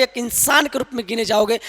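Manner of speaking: ordinary talk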